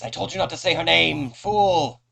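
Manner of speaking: high, loud furious rebuke; furious shout. Acted. High priest scolds the cultist for saying her name, furious. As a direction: roar it down at the fool.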